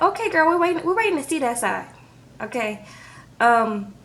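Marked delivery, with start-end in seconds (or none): 0.0-1.9 sassy skeptical. Retold or imitated. Viewers mocking Andrea's claims.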